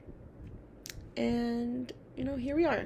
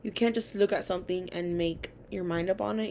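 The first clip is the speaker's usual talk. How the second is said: usual speaking voice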